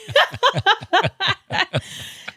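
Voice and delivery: high, rolling laugh; real laugh. Real, not acted. Joking to host about an Uber after a jet, high laugh. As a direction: burst out laughing at your own joke.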